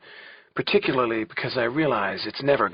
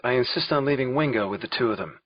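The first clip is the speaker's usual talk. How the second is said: plain reading voice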